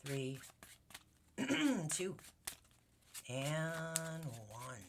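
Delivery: quiet. Real, not acted. Pulling cards in a quiet ritual.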